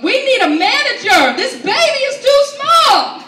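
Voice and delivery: very high, loud mock yell; drawn-out hammed-up mom shouting; imitating crazy mother. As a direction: demand service like an outraged customer. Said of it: "My crazy mother".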